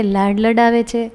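ordinary delivery, nothing marked

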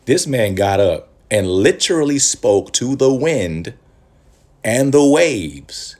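preacher marvelling to the congregation, slow and loud; awed emphasis. Real, not acted. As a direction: stretch every word in wonder at the miracle. Preaching awe at Jesus' power.

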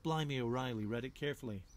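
usual speaking voice

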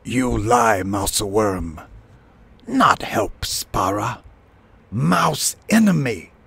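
furious bird tyrant, rising to a high cry; angry shriek. A narrator's reading. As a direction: shriek the accusation in short bursts. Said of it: "he shrieked".

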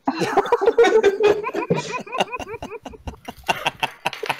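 long, high-pitched laughing fit; helpless laughter. Real, not acted. Joker cracks up among friends, helpless high laughter. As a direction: crack up and keep going.

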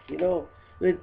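ordinary talk

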